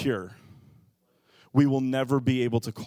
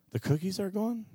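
soft, higher, rising question; sleepy little girl; 5 semitones higher, 6 dB quieter than usual. Retold or imitated. Waking daughter hears cookies are gone, sleepy questioning. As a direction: ask it softly in a small child's voice. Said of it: "they're still kind of asleep and they're adorable".

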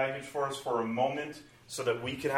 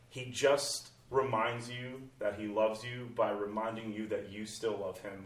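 usual speaking voice

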